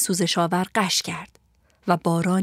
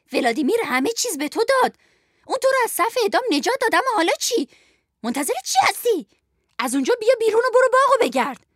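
very high, fast, nasty scolding; high-pitched wicked voice; 12 semitones higher than usual. Acted. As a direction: berate underlings as a mean villainess. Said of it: "از صدای زیر و بدجنسش شناخت". (صدای زیر و بدجنس)

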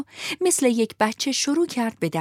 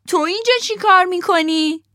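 much higher, louder cat parroting the fox; cat repeats the fox, higher still; echoing cat voice; 11 semitones higher, 6 dB louder than usual. Acted. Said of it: "گربهه تکرار کرد".

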